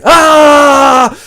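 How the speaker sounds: high loud scream; pain scream. Acted. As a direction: cry out at the shock.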